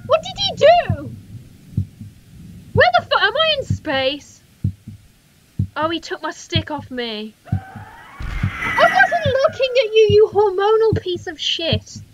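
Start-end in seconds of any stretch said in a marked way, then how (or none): 0.1-4.4 high, loud, startled questions; bewildered alarm. Real, not acted. Player bewildered by game event. 7.5-12.0 high, loud, drawn-out protest; indignant yelling. Real, not acted. Player rages at monster's attack.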